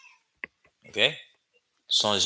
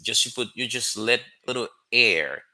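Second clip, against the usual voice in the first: usual speaking voice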